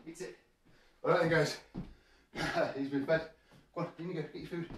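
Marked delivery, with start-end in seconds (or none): 0.1-0.4 hushed coaxing; quiet coaxing call; soft aside to dog. Real, not acted. Calling dog to food.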